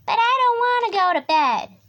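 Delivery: put-on high, loud young tiger whine; protesting Little Tiger. A narrator's reading. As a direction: protest like a stubborn little cub. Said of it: "Little Tiger would say".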